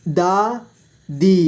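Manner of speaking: दादी split slowly into दा, दी; syllable by syllable. Shown as a demonstration. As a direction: stretch and separate दा and दी in दादी. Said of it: "इस तरह से आपको". (दादी को तोड़कर धीरे: दा, दी)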